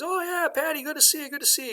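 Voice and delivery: high-pitched, acted welcome; hearty greeting. Boss greets employee at his door.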